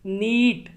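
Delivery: नीट with the ee held long; long ee in neat. Shown as a demonstration. Teacher showing the long vowel in नीट. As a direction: stretch the ee in नीट. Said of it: "तो ई का जो sound है, वो ज़्यादा देर तक रहता है".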